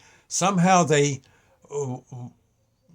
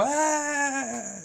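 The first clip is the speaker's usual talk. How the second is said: put-on kids' cheer; 14 semitones higher, 3 dB quieter than usual. Kids cheer on cue.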